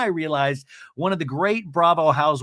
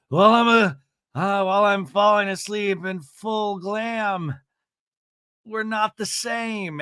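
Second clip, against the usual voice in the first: slow, far-off smug drawl; a put-on sleepy diva voice; mock smug Emily; 4 semitones higher, slower than usual. Mocking Emily's bedtime glam boast. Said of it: "she's like".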